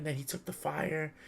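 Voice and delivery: normal talking voice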